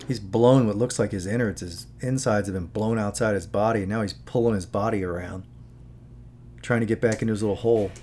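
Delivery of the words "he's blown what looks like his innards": ordinary delivery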